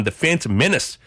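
ordinary talk, nothing marked

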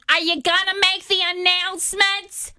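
high, pushy mock demand of a rude passenger; rude lady. Passenger pestering the gate about announcements, mock rude and high. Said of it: "she is so rude".

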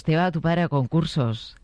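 ordinary talk

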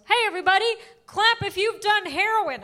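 high-pitched stand-up crowd call; open-mic comic. Retold or imitated. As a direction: hype the room like an eager comic. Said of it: "First comic gets on stage".

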